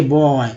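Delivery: बॉय as one drawn-out syllable; correct word reading. Shown as a demonstration. Teacher repeats the right reading for learners, as one word. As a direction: say बॉय whole, stretched "bo-oy". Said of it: "हम लोग बोलते हैं".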